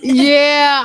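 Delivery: delighted shout; excited yelp. Real, not acted. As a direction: whoop the yes. Excited reaction.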